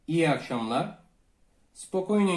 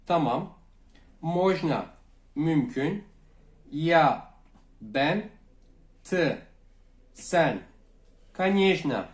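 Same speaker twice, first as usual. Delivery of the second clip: normal talking voice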